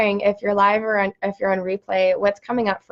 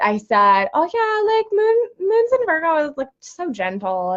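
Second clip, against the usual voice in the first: high, airy voice quoting herself; light, much higher-pitched mock breeziness; breezy self-quote; 9 semitones higher than usual. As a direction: imitate your own carefree earlier remark up high. Airily quoting her earlier self.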